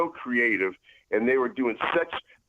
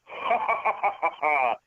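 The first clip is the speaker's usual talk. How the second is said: booming staccato villain laugh; Uncola guy's laugh; 4 semitones higher than usual. Shown as a demonstration. Wrestler showing the borrowed laugh, deliberate staccato laugh. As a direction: laugh it out in slow, separate ha-has. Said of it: "I took that laugh from the Uncola guy".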